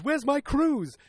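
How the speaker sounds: high, loud mock demand; indignant demand. Dad demanding his promised cruise, indignant. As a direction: whine it up high like someone cheated. Acted quarrel over cancelled cruise.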